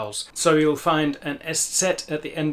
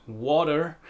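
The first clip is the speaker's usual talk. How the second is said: American "water" with the R heard at the end; American accent. Shown as a demonstration. Teacher repeating the American version, American accent. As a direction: curl an American R onto "water". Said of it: "clearly hear the, the letter R at the end".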